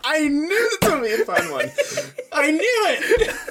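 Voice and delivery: high, squeaky words through helpless laughter; real laughing fit, nearly crying; laughing hard. Real, not acted. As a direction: barely get it out while cracking up. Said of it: "last time I laughed that hard".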